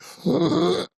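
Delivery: sharp high gasp of shock. Retold or imitated. Gasping at the news that he'd felt his dad die. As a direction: gasp as the coincidence lands. Learning the time matched his dad's death.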